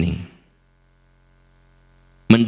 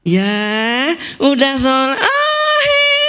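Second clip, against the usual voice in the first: sing-song praise, far higher; kindergarten teacher; slower than usual. Retold or imitated. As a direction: gush over a small child like a TK teacher. Guru TK memuji murid. (guru TK memuji murid dengan nada tinggi bernyanyi)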